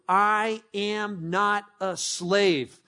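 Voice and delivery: very slow, each word weighed; slow declaration. Shown as a demonstration. Pastor giving the church a phrase to repeat, slowly. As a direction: say it like a creed. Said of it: "these five words are true".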